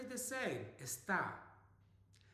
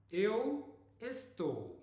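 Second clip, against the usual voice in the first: slow, careful Portuguese model; slow model phrase; 4 semitones higher, 3 dB louder, much slower than usual. Shown as a demonstration. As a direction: say it slowly for learners to copy. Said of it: "we are going to be learning estar".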